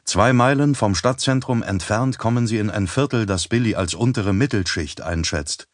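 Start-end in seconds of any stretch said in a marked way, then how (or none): none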